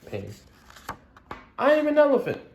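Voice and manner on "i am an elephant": high, loud cry of not fitting in; upset Gerald. Acted. Gerald telling Piggy he is an elephant, high and loud. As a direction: lament being different.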